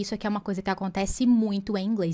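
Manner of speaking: ordinary talk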